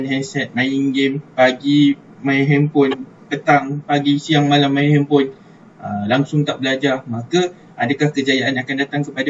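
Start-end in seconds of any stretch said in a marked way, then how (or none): none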